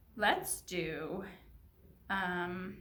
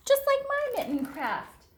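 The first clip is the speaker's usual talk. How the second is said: high, gasping with surprise; delighted gasp; 13 semitones higher, 5 dB louder, slower than usual. Real, not acted. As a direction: gasp and light up at the match. Teacher gasps at matching mitten.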